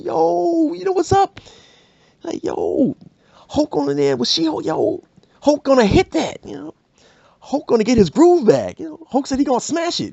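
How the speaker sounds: high, pushy instigator stirring drama; hype man. Acted. Hulk hyping to crowd.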